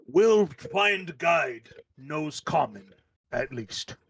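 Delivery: very slow, halting giant speech; ponderous elder in broken Common; frost giant elder. Acted. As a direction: weigh each word like an old giant. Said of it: "the one that had talked to you earlier".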